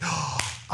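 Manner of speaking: a quick voiceless gasp of discovery; sudden excited intake of breath; sharp gasp. Retold or imitated. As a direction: suck in air as the idea hits. Excited idea to buy strawberries.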